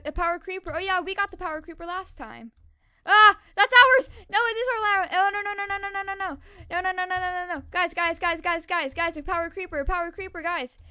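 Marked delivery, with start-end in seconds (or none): 3.1-4.1 high, loud alarmed cry, slowed; real alarm as it comes; alarmed yell. Real, not acted. Player alarmed the creeper is in their lane, loud high yell. 4.3-7.6 panicked noes. Real, not acted. Creeper threatens their lane. 7.7-10.8 urgent warning. Real, not acted. Warning teammates.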